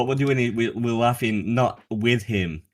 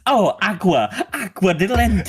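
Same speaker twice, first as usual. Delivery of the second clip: high sing-song fake Spanish; mock Spanish accent; 9 semitones higher, 3 dB louder than usual. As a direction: imitate a comic riffing wildly in Spanish accent. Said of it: "and he'll go".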